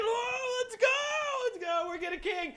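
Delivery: excited cheering. Acted. Israelites celebrating king.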